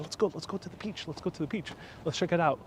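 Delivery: hushed, quick urging; acted older woman. Older woman urging her husband towards the peach, hushed. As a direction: urge him along very softly, as if confiding. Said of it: "older woman goes, "Hey," to her husband".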